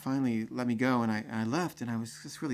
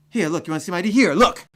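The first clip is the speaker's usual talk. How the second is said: loud, teasing, higher-pitched shout; taunting shout; 4 semitones higher, 12 dB louder, faster than usual. Retold or imitated. Taunting the security guard with the ID, loud shout. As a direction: wave it in his face and yell.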